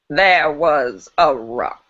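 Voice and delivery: put-on slow old lady poet voice; lightly voiced old poet, very slow; Maya Angelou. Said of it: "solid Maya Angelou, old lady voice".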